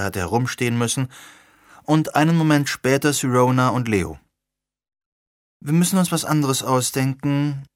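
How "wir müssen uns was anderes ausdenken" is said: ordinary delivery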